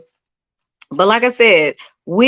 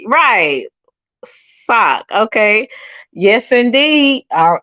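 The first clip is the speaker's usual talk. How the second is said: usual speaking voice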